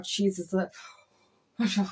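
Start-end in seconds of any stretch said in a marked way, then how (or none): none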